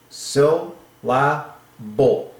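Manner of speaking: "Sil, la, bull" in separate, spaced beats; syllable by syllable. Shown as a demonstration. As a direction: chop it up: "Sil", pause, "la", pause, "bull". Sounding out syllable piece by piece.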